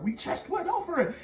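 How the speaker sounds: high, exasperated teacher voice; impatient teacher scolding; hammed-up strict teacher; mock teacher snap. Teacher snapping at a wrong answer.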